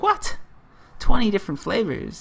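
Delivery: high, astonished imitation; mock disbelief. Foreigners hearing of many flavours of water, mock disbelief.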